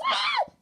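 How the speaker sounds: high sharp scream of panic; short frantic shriek; panicked scream. Real, not acted. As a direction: shriek in sudden alarm. Panic at timer.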